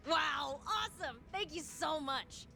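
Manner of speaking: high-pitched excited thanks; thrilled thanks. Acted. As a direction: gush with delight at getting a yes. Thrilled that the favour is granted.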